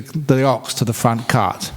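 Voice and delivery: ordinary delivery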